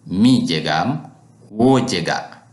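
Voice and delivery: Mi and wo stressed before the verbs; emphatic pronouns. Shown as a demonstration. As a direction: lean on Mi and wo to mark who acts. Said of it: "if I want to emphasize you".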